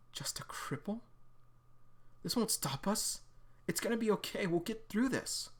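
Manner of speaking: Sarah whispering to herself through tears; crying whisper. Acted. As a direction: hold back tears, whispering. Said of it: "Oh, and she's crying".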